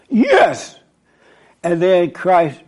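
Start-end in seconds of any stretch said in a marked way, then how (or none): 0.1-0.8 excited yes. Retold or imitated. Disciples cheering Christ.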